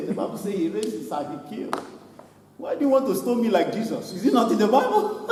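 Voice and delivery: normal talking voice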